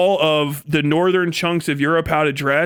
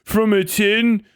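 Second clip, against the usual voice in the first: mock British family; 2 semitones higher than usual. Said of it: "it'd be like".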